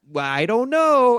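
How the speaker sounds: high, slow, coy dodge; the newlywed dodging the accusation, in a high, slow, innocent voice; mock shrug. Buddy's wife dodging accusation.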